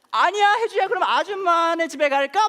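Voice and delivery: very high, loud, slow alarmed auntie; alarmed woman. Retold or imitated. Shocked neighbour urging girl to come home with her, very high and loud. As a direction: shriek it out in shocked concern. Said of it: "얼굴이 완전히 놀라가지고".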